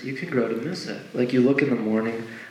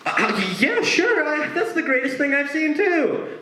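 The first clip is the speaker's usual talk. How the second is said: much higher, strained fake cheer; forced enthusiasm. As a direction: cover the lie with squeaky cheer. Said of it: "you're like".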